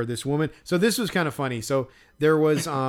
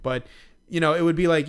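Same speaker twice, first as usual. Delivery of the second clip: ordinary talk, nothing marked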